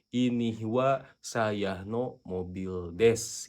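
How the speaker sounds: slow, deliberate dictation; slow model sentence. Shown as a demonstration. Teacher showing the reversed sentence slowly. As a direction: say it slowly, word by word. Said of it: "berarti bahasa Jepangnya adalah".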